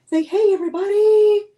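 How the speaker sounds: loud, high coaxing call; loud high greeting. Shown as a demonstration. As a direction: belt the greeting for the dog.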